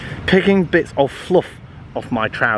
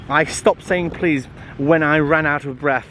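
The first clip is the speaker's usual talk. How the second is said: ordinary delivery, nothing marked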